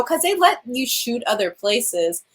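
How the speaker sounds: normal talking voice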